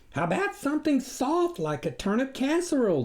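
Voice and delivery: high, folksy country wife; farmer's wife offering. A narrator's reading. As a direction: offer it up sweetly, pitched high. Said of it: "the farmer's wife offered".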